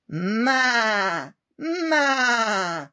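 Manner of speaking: high, loud bleating maa; goat bleat. Acted. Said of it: "said the goat".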